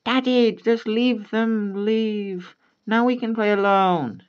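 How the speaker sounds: put-on high, soft, slow child's plea; calm little girl. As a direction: gently hold daddy back. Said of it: "in a calm voice".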